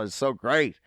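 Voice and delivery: ordinary talk, nothing marked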